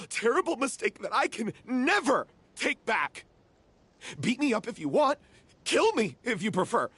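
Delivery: anguished outburst. Acted. Vilmont begging Paulo to punish him, anguished shouting. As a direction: hurl the guilt out at them. Guilt-ridden outburst in quarrel.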